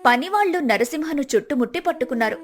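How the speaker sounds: ordinary delivery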